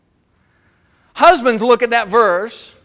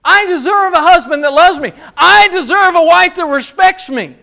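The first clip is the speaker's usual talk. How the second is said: louder, higher entitled whine; entitled mock voice, raised; mock entitlement; 7 semitones higher, 8 dB louder than usual. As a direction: ham up the sense of being owed. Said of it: "See, we're saying".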